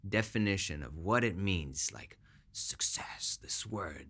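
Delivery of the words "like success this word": breathless whisper, slowed down. Real, not acted. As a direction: lean in and whisper it. Hushed aside stressing the word success.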